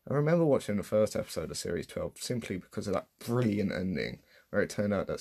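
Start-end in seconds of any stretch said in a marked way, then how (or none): none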